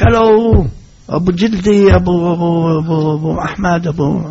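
knocking and calling at the door at night. Retold or imitated. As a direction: shout to wake him inside. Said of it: "דופק, צועק".

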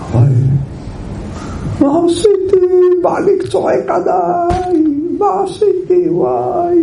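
high, crying wail of a hurt wife. Retold or imitated. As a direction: sob out the question, voice breaking high. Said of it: "נכנסה לבית והייתה בוכה".

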